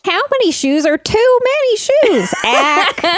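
high, laughing cartoon-character wail; Cathy comic voice. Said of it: "This is a real Kathy style question".

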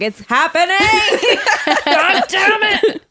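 ordinary talk

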